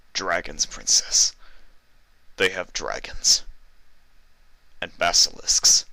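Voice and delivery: frightened halting report, a breathy fearful word; guard fearfully naming the monsters; shaky. Acted. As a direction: deliver it trembling with dread. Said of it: "the guard said shakily".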